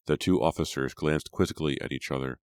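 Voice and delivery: ordinary delivery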